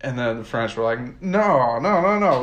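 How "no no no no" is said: higher, louder repeated no; mock emphatic refusal. France refusing Churchill with a higher, louder no. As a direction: wave off the offer hard.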